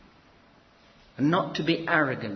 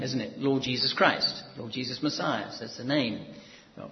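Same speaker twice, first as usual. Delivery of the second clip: ordinary delivery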